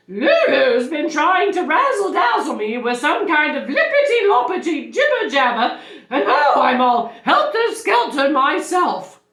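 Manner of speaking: high, loud and all in a tizzy; teacher at her wits' end, high-pitched; flustered teacher. Acted.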